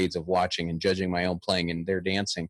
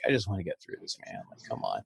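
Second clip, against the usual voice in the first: tired mutter; 3 semitones lower, 8 dB quieter, faster than usual. Retold or imitated. Exhausted at end of day.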